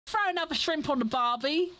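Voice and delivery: bad Australian accent. Shown as a demonstration. Showing the only Australian line they can do, in a bad Australian accent. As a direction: have a go at a clichéd Australian accent, badly. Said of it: "I know that was a bad Australian accent".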